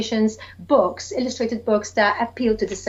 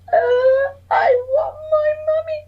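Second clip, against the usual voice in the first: put-on much higher crying baby owl voice; 3 dB louder than usual. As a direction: wail for mummy like a baby owl. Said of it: "says baby Bill".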